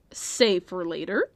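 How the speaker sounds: ordinary talk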